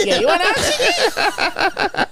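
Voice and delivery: high drawn-out teasing; high slow taunting voice. Retold or imitated. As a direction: tease slowly with a laugh. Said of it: "He's like".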